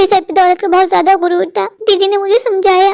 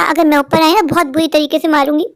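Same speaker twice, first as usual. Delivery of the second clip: usual speaking voice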